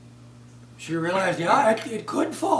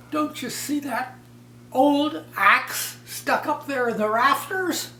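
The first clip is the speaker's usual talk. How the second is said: put-on high, wailing worried girl, cracking into laughter at the end; a high, near-tearful voice that breaks into giggles; fretful silly daughter; 6 semitones higher, 5 dB louder than usual. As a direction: play the silly girl working herself into a fright. Silly daughter fears imagined accident.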